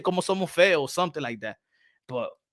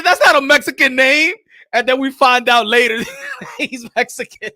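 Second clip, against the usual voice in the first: laughing hard; 7 semitones higher, 7 dB louder than usual. Real, not acted. Laughing over Saved by the Bell.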